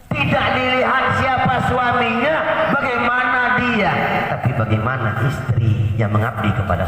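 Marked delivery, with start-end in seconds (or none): 0.1-3.9 high, forceful sermon line; raised preaching voice. Real, not acted. Preacher admonishing wives about serving husbands, high forceful preaching. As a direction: proclaim it with pitch held up.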